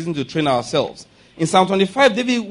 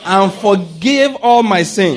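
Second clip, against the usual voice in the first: slow declaration; 5 dB louder, slower than usual. Shown as a demonstration. Call and response declaration.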